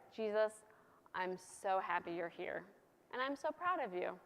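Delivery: lightly voiced tender father God. God telling Jesus softly he is proud. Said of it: "he says in a very God way".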